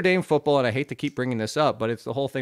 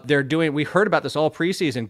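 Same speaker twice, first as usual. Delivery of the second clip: normal talking voice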